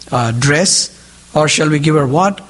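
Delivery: ordinary delivery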